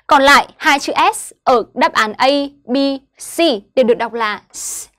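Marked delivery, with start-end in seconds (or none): 4.5-4.9 "s" as a lone light hiss; light S sound. Shown as a demonstration. Teacher names the sound of double S in other words, light S sound. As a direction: hold "s" thinly, with no vowel. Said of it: "nhẹ".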